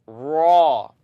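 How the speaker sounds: raw loud with the tongue rolled on r. Shown as a demonstration. Teacher demonstrates a rolled r in raw. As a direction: roll the r hard on raw. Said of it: "You take your tongue and say".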